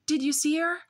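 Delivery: put-on high, eager little girl voice; bursting to know; excited. Little sister asks her brother, excited and high. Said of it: "she asked excitedly".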